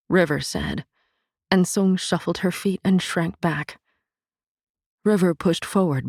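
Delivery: plain reading voice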